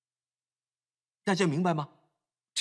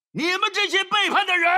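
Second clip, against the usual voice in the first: high, loud furious rebuke; Moses's angry shout; 11 semitones higher, 5 dB louder than usual. Retold or imitated. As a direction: yell it at the rebels like Moses. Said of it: "他却是这么做". (摩西怒斥百姓，高声喊叫)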